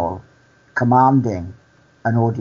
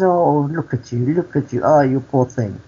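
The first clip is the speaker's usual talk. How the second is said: pitched-up mock pitying coo; imitated pity; 4 semitones higher than usual. Storyteller voices the cleaner pitying the patient, imitated pity. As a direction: croon over him as a pitiable case. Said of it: "you sound like you're upset".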